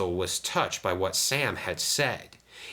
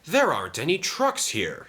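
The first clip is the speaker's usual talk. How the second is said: higher puzzled engine voice; talking to himself; 9 semitones higher than usual. A narrator's reading. Sam alone at the works, puzzled to himself. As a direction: wonder aloud as a puzzled little engine. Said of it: "he said to himself".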